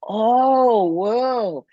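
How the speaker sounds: high, loud drawn-out oh, easing into whoa; stunned realisation. Retold or imitated. Retold stunned realisation about own words.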